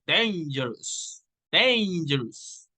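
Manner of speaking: "dangerous" drawn out slowly on DAN; stress on first syllable. Shown as a demonstration. Teacher showing primary stress to learners, slow stressed first syllable. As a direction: lean long on the DAN of "dangerous". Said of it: "or ten".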